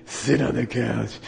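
slow command from a damaged-voice lama; Darth Vader. Retold or imitated. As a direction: imitate a teacher repeating an order through a crushed voice box. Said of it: "he talked like Darth Vader".

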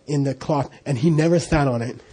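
ordinary delivery

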